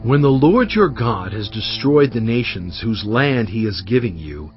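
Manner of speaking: normal talking voice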